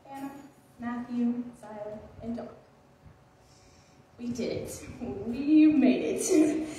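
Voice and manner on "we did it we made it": slow, louder proud exclamation; triumphant. Real, not acted. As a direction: stretch it out like a victory cheer. Triumphant graduation cheer to classmates.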